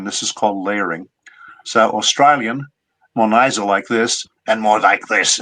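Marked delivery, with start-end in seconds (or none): 1.6-5.4 nasal Australian accent dropped into the throat; Aussie, nasal and from the throat; throaty nasal Australian accent. Shown as a demonstration. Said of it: "a throaty quality".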